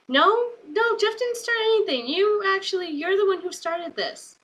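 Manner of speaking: high, squeaky mock protest at the TV. Watching with mom, arguing with the wrestler on TV, high mock protest. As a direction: pitch way up in disbelief, talking back to the screen. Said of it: "we were like".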